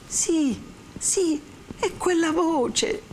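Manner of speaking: high, drawn-out cry of recognition; breathless recognition. Acted. Renzo hears the lost voice, high and amazed. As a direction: let the joy lift each word high.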